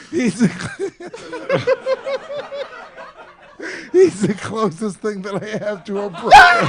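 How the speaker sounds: helpless laughter breaking into the words; laughing to tears. Real, not acted. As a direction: crack up mid-sentence and force the line out. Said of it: "I'm crying".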